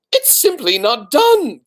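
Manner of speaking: put-on high, slow prim old aunt; Aunt Florence. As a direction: sniff it out like a scandalised aunt. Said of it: "came Aunt Florence's voice".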